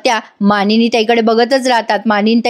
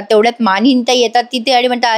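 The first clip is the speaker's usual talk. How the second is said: normal talking voice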